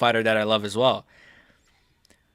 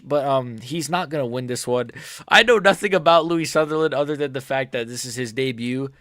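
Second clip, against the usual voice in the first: ordinary delivery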